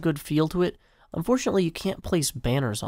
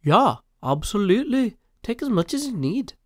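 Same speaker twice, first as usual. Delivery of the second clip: higher, louder put-on British accent; a hammed-up British voice, bright and bouncy; 7 semitones higher, 4 dB louder than usual. Reading Mumbo's chat reply in a mock British accent. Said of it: "he uses a British voice".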